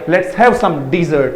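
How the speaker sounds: dessert very slow, stress on the second syllable; teacher modelling dessert slowly and clearly; correct pronunciation. Shown as a demonstration. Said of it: "correct pronunciation के साथ बोलो".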